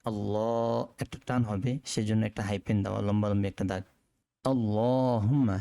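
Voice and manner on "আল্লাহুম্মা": আল্লাহুম্মা with the long drawn vowel, slow. Shown as a demonstration. Teacher shows the hyphen's long vowel in আল্লাহুম্মা. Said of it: "এটা একালি টান হবে".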